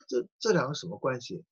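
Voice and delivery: normal talking voice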